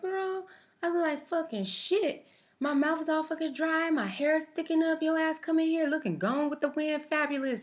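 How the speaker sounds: high, sassy put-on voice; sassy retort. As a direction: camp it up, pitched high.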